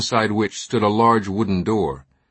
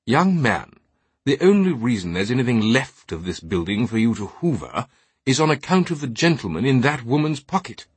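angry British knight; 3 semitones higher than usual. Acted. Knight glaring at the altar boy, indignant rebuke. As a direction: scold the lad with lofty indignation. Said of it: "The man on crutches glared angrily".

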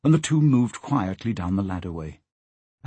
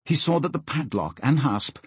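ordinary delivery, nothing marked

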